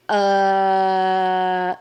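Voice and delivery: long, level "Uh" held on one note; drawn-out hesitation. Retold or imitated. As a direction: stall on a droning "Uh". Stunned reply on arriving at church.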